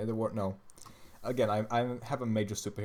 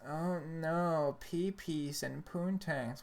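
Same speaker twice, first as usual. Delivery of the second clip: mock prude; 7 semitones higher, much slower than usual.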